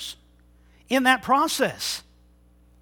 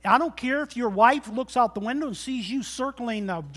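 ordinary talk

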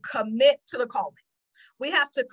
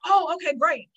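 eager; 4 semitones higher, 5 dB louder than usual. Retold or imitated. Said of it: "because I'm a doer".

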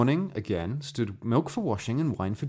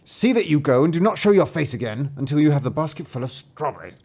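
commanding stepmother, louder; wicked stepmother; 5 semitones higher, 6 dB louder than usual. A narrator's reading. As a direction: order her out coldly. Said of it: "said the stepmother".

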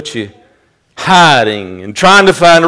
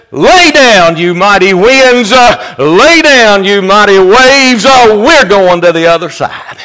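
high, loud command to the winds, lay drawn out; Jesus commanding the wind and waves, thundering; commanding rebuke; 8 semitones higher, 8 dB louder than usual. Pretend. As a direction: order the storm down with full authority. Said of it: "He rebuked it".

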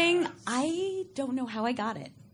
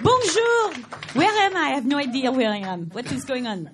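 put-on French accent; 8 dB louder than usual. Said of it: "it's a French accent she's doing".

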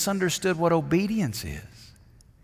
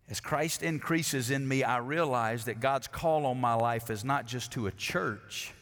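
ordinary delivery

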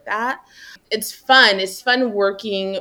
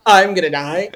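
louder, hammed-up overwhelmed groan of a line; mock despair; 6 dB louder than usual. Coworker swamped by task dings, mock despair. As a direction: play the swamped coworker, half joking.

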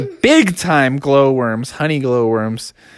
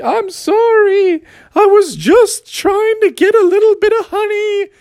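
high bear apology. Acted.

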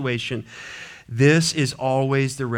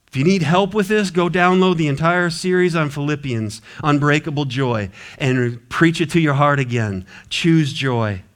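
normal talking voice

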